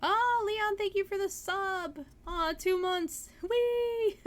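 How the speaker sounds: very high, loud delighted squealing; excited squeal. Real, not acted. Streamer thanking Leon for the sub, squealing with delight. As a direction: gush with thanks, thrilled. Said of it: "That makes me happy".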